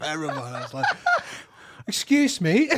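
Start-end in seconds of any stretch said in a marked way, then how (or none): 1.9-2.7 high, loud mock-English "excuse me"; put-on English accent, laughing. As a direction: squeal it in an affronted English accent. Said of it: "they sat there speaking in English".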